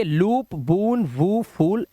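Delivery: "लूप, बून, वू, फूल" with stretched oo; word list with long oo in "फूल". Shown as a demonstration. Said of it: "सबसे पहले ऊ उच्चारण".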